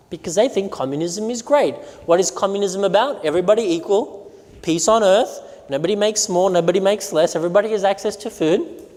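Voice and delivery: ordinary talk, nothing marked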